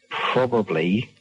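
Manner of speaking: ordinary talk, nothing marked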